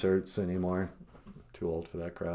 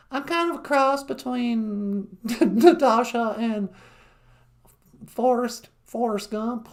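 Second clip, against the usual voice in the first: much higher, drawn-out, mock Southern US accent; 6 dB louder than usual. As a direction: ham up a Southern belle introducing herself. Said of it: "a southern accent".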